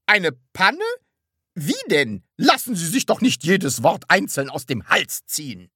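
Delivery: high, loud and impatient; impatient questioning. A narrator's reading. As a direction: prod them to get on with the story. Impatient listener presses for the story. (ungeduldig drängend)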